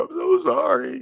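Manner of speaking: squeaky high "I'm so sorry", sing-song; high mock apology. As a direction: pitch it way up like a cartoon apology. Apology to chatter, playful.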